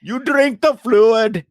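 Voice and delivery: put-on high, slow Indian accent. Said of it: "not a terrible impersonation of an Indian accent".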